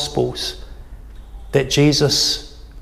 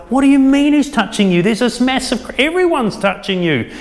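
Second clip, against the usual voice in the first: higher, incredulous retelling of the disciples; mock-baffled disciples, voice raised high; exasperated disciples; 8 semitones higher than usual. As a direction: act out the disciples' disbelief, pitched up.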